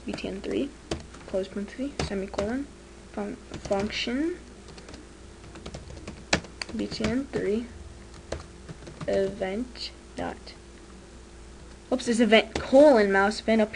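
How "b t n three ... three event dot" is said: quiet dictating. Shown as a demonstration. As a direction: murmur each symbol as you type it. Typing code, reading it aloud.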